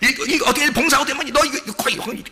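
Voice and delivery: mock pushy nagging. Mocking pushy church demands.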